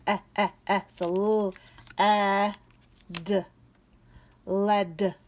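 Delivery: eh repeated, l, eh, d, led blended; sounding out. Shown as a demonstration. Teacher sounding out led for kids. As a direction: sound out led one sound at a time. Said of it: "What does short E say?".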